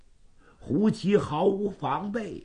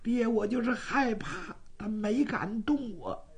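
put-on higher, softer girl's voice; timid girl; 6 semitones higher, 6 dB quieter than usual. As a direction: answer like a shaken young girl. Frightened girl answers her father.

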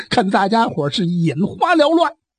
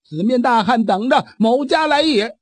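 usual speaking voice

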